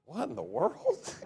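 high, hushed, drawn-out disbelief; bystander's baffled thought, pitched high and soft; mock bewilderment. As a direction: softly squeak out baffled disbelief. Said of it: "is thinking, like".

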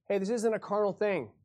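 ordinary delivery

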